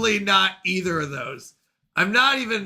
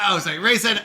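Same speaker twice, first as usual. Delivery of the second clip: ordinary talk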